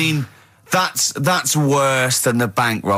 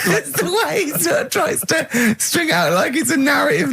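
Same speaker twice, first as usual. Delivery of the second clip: high, squealing laugh through the words; laughing too hard to talk normally; laughing disbelief; 14 semitones higher than usual. Real, not acted. Co-host ridiculing the monkey storyteller, laughing high.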